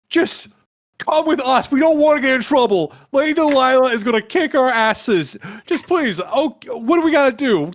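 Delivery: high, fast pleading guard voice. Acted. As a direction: beg like a nervous guard. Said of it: "I was like".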